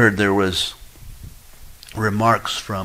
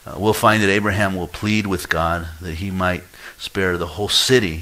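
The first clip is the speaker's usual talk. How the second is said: ordinary talk, nothing marked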